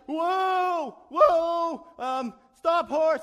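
high, loud cries to a runaway horse; hammed-up frantic shout; panicked shouting. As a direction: yell in comic panic.

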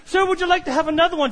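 quick sample lady. Retold or imitated. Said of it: "And she says".

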